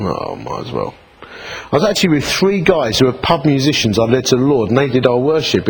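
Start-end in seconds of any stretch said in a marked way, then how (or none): 0.0-0.9 resigned mumble. Retold or imitated.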